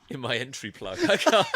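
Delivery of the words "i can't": loud, high laughing gasp; too tickled to carry on; helpless laughter. Real, not acted. Podcaster tripping over his own words, helpless laughter.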